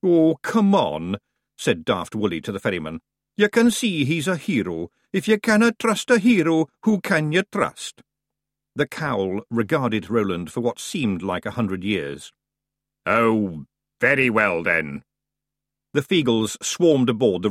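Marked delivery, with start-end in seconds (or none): 0.0-8.0 higher Scots wheedling; Scottish Feegle pleading. A narrator's reading. Daft Woolly voiced in Scots. 13.0-15.0 the ferryman of the dead, fed up; deathly ferryman. A narrator's reading.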